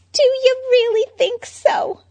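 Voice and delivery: high, loud bubbly Harley. Acted. Harley chatting to Bruce about presents, bubbly. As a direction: gush like a ditzy fangirl.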